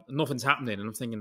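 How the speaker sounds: ordinary delivery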